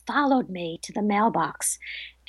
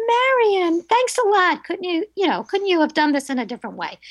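much higher, exasperated sarcastic complaint; mock yell; 8 semitones higher than usual. Friend's retold gripe about the bird mess, mock-exasperated and high. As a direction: scold the dead friend in jest, pitched way up.

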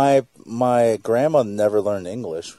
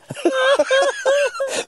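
real laugh, pitched way up; high squealing laugh. Real, not acted. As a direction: burst into a high giggle. Stumbling on a word.

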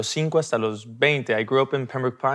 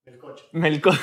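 laughing; 3 semitones higher than usual. Real, not acted.

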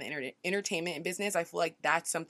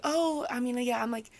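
higher, hesitant "I'm a business owner"; awkward downplaying; 4 semitones higher than usual. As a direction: say it like you barely believe it. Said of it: "I felt really odd saying that".

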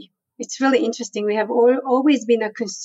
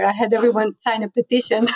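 ordinary talk, nothing marked

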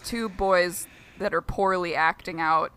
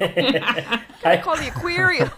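slow, higher mock Boston accent; 5 semitones higher, slower than usual. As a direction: imitate a Boston boater through laughter. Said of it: "talks about the Boston accent".

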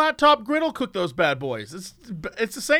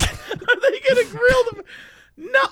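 laughing. Real, not acted. Repeating the story, cracking up.

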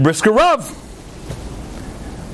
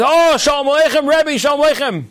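eager greeting; 7 semitones higher than usual. Retold or imitated. Rabbi eagerly greets honored guest.

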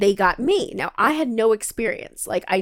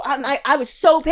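ordinary talk, nothing marked

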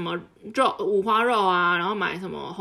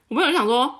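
ordinary talk

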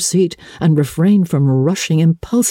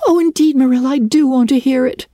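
put-on high, quick, eager girl's plea; a young girl rushing to apologize; contrite girl; 12 semitones higher, faster than usual. A narrator's reading. Anne pleading with Marilla, high and quick. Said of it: "cried Anne contritely".

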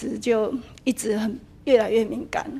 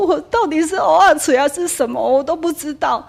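higher, louder baffled wondering; bewildered; 5 semitones higher, 6 dB louder than usual. Retold or imitated. Speaker baffled about her own foresight, higher and louder. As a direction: ask yourself in disbelief. Bewildered thought after the accident.

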